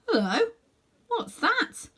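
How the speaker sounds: sudden high surprise; startled, spotting something; surprised. A narrator's reading. Sudden surprise at guinea pig.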